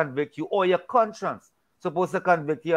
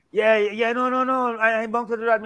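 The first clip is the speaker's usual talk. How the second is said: mocking a proud brush-off, higher; proud denial; 6 semitones higher than usual. Imitating a proud person refusing to admit fault, dismissive. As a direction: toss it off like pride won't admit a mistake. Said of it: "allow pride to come in and, and pretend that".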